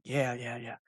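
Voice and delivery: imitating a dad's soft, quick go-ahead; hushed dad quote. Dad giving his son the okay in the blind, hushed. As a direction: answer under your breath, keeping the doe unaware.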